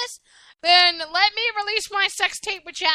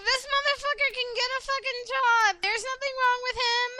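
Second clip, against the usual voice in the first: mock ranting voice; 6 semitones higher than usual. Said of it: "I was like".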